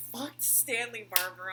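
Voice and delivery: loud, slow, punched-out quote; imitated outburst. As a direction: blurt it loudly, dragging the words. Acting out Dina's shocked outburst.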